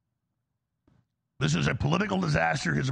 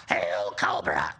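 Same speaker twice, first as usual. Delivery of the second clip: far lower, slow villain salute; Cobra Commander; 13 semitones lower, much slower than usual. Retold or imitated. Cobra Commander impression closing his threat, deep and slow. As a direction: salute the cause in a deep cartoon rasp. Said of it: "Or like Cobra Commander".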